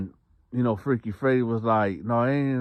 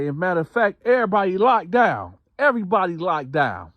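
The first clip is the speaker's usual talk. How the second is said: high, loud lockdown command; guard shouting orders; 10 semitones higher, 6 dB louder than usual. Retold or imitated. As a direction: call the whole block to lock down at full volume.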